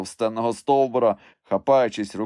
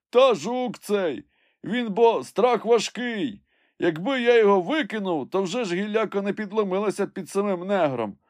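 servant calling down; 11 semitones higher than usual. A narrator's reading. Climber blames the heavy beetle, high complaining call.

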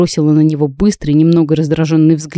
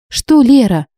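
heated, pitched-up protest; indignant outburst; 6 semitones higher than usual. A narrator's reading. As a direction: throw his scolding back at him. Angry retort to scolding.

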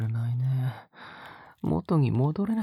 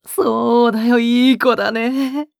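much higher and louder, delighted praise; gushing praise; 10 semitones higher, 13 dB louder than usual. Acted. Captor praising Rabi-chan for her answer, higher and louder gushing praise. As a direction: burst out praising a good girl.

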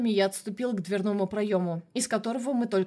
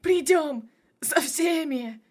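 feverish dying threat; 8 semitones higher, 4 dB louder, much slower than usual. A narrator's reading. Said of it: "Умирающий засмеялся и затрясся".

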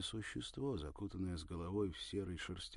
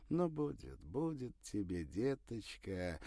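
slow, tender, comforting; calm her gently; soothing; 4 semitones higher, 3 dB louder, slower than usual. A narrator's reading. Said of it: "приговаривал Порфирий, поглаживая её".

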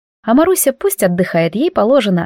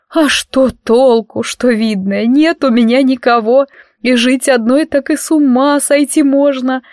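sobbing in a deep bass; 4 semitones higher, slower than usual. Acted. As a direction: howl it out in a low bass. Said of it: "проревела ещё более понизившимся басиком".

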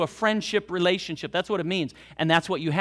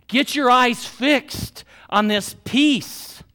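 slow, emphatic, loud exhortation; forceful preaching; 4 semitones higher, 4 dB louder, slower than usual. Real, not acted. Preacher urging the congregation, slow and forceful. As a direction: drive every word home to the flock. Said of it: "preaching at full force".

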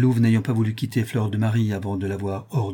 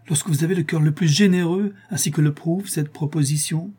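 high-pitched, insistent exclamation; exclaiming; 7 semitones higher than usual. A narrator's reading. The count praises the doctor's kind heart, exclaiming. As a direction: keep the voice lifted, protesting the point. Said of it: "s'écria le comte".

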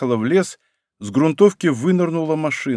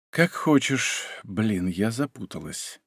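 soft and slow, almost under her breath; quiet; 2 semitones lower, 7 dB quieter, slower than usual. A narrator's reading. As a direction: murmur it quietly to herself. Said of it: "тихо проговорила девушка".

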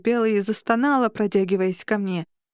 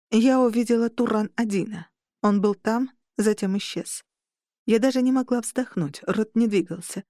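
plain reading voice

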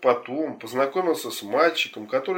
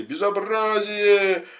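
high, loud, drawn-out outrage; outraged cry; 9 semitones higher, 4 dB louder, much slower than usual. Acted. Passers-by shouting at the scene in the park, high drawn-out outrage. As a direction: stretch it out like a scandalized passer-by. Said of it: "разные люди и кричали".

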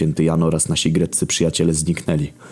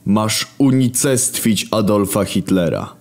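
lofty raised voice of the Creator; wounded divine authority; God; 5 semitones higher, slower than usual. Acted. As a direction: speak down from heaven, aggrieved. God orders execution.

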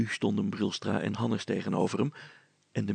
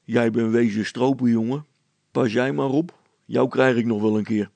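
louder, scoffing village constable; scornful constable threatening the young man, louder; 8 dB louder than usual. Acted. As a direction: sneer it at the young poacher. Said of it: "En hij zei schamper".

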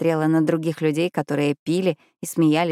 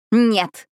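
a sharp cry, higher and louder; shouted no; 6 semitones higher, 4 dB louder than usual. A narrator's reading. Alina screams no at Luka, a short shout. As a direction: fling the word out as she throws the glass. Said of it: "закричала я".

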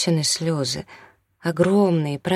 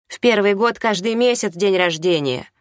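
high, forceful rebuke; hostile; 5 semitones higher, 4 dB louder than usual. A narrator's reading. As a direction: talk to him like an enemy. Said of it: "разговаривала с ним как с врагом".

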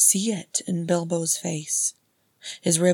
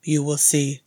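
louder, dark promise; 2 semitones lower, 5 dB louder than usual. Acted. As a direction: answer darkly, closing the subject. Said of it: "he said darkly".

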